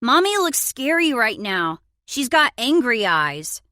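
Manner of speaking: put-on high, loud child's voice; little boy. A narrator's reading. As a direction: tattle like a small kid calling from the back. Young son voiced from the background.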